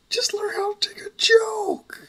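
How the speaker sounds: high, tearful whispered plea; crying whisper. Acted. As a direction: whimper it through fake tears. Crying plea while threatened.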